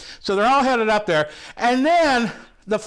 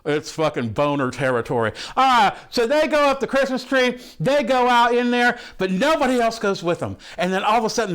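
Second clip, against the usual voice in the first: ordinary talk, nothing marked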